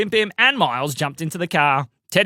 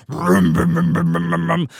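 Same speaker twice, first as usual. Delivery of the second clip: engine rumble; 4 dB louder than usual. A narrator's reading. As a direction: rumble like a car starting. Car engine starting.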